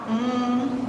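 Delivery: ordinary talk, nothing marked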